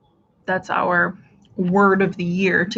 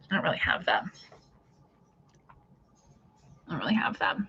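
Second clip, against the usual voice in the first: lower, whispered to herself; trailing whisper; 4 semitones lower, 12 dB quieter, faster than usual. Real, not acted. Host musing about her fabric, whispered aside. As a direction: murmur it as an aside.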